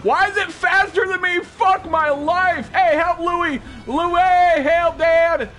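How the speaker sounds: high, loud frantic calls; real frightened cries for help; panicked shouting. Real, not acted. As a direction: yell for your teammate to save you. Chased, calling teammate Louis.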